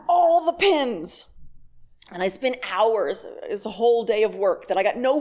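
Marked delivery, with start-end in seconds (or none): 0.1-1.3 mock exaggeration. Real, not acted. Mocking her own Pinterest binge.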